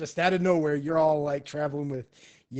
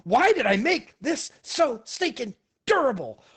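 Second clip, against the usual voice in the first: higher, louder frustrated outburst; the alienist yells at his own box, frustrated; 10 semitones higher, 6 dB louder than usual. Retold or imitated. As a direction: yell at the stubborn box. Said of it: "He's like".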